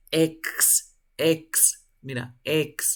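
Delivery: "ex" sounded out clearly, ending in K-S; isolated X sound. Shown as a demonstration. Showing a student how the X ending sounds, isolated and emphatic. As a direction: repeat "ex" separately, leaning on the final hiss. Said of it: "¿Vibra o no vibra?".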